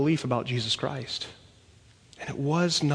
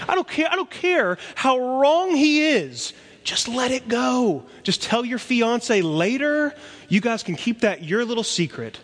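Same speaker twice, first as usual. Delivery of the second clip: urgent advice; 8 semitones higher than usual. Real, not acted. Pastor urging engaged young men in the room, high and urgent. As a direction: plead it high and urgent, half joking.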